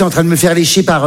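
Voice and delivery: ordinary talk, nothing marked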